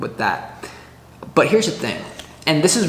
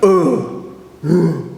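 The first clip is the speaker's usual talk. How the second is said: ogre grunts; 3 semitones higher, 3 dB louder than usual. Retold or imitated. Ogre swinging and missing.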